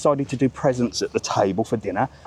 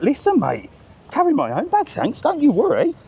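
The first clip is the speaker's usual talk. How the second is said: lightly voiced, higher breezy brush-off; politely refusing help; 14 semitones higher than usual. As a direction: wave the porter off with a cheery high tone. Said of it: "and I'm like".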